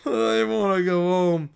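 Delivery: slow, drawn-out whimper; mock homesick wail.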